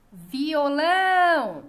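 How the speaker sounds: "Violão" called loudly, LÃO drawn out slowly; calling out the word. Shown as a demonstration. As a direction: call out "Violão", stretching the final LÃO. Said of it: "esse exercício de chamar as palavras".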